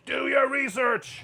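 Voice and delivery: mock grand announcement of a thesis; mock conspiracy title. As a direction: drag out the title like a crank's slogan.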